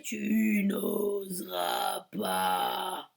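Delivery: very slow, drawn-out menacing taunt; cavernous taunt. Acted. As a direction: dare him, slowly. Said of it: "Une voix caverneuse profonde".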